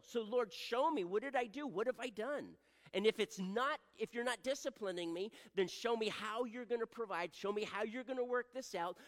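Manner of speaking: loud, high-pitched plea to the Lord; urgent pleading prayer. Shown as a demonstration. Believer asking the Lord what he has done, urgent pleading. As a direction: cry out for answers, pressing hard.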